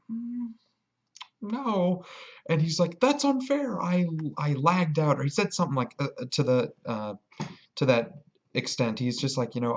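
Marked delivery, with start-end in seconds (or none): 0.1-2.0 "Mm, no" sung out very high and teasing; teasing refusal. Retold or imitated. Vlogger refusing the player his sword, high and teasing. 3.0-5.2 very high whining complaint; whiny player. Retold or imitated. Player complaining to the vlogger, in a very high whine.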